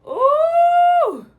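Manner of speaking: high, loud squeal of delight; excited squeal. Real, not acted. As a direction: squeal at the screen. Reacting to the game.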